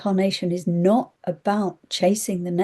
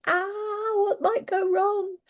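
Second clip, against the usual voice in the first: much higher, small worried voice; fretful little voice; 14 semitones higher, 3 dB quieter than usual. Retold or imitated. Her head's little voices, high and worried. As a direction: mimic the nagging inner doubt. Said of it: "little voices that come in and go".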